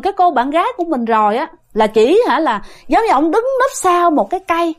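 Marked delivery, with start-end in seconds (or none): none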